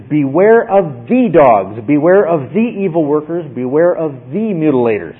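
"the" stressed as a long "thee" each time; slow down and lean on every "the"; emphatic. Shown as a demonstration. Preacher showing Paul's repeated definite article, emphatic on "the". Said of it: "He's emphatic".

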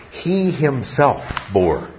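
"He himself" slow and weighty, falling away; slow, deliberate emphasis on "He himself"; stress on "He himself". Shown as a demonstration. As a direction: draw out "He himself" before "bore". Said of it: "The emphasis upon Christ".

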